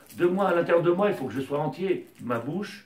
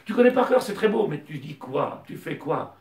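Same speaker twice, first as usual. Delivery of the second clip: raised, louder sermon voice driving each question home; fervent preaching; 4 semitones higher, 6 dB louder than usual. Acted. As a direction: preach it to the congregation with fire. Rabbi preaching at full force.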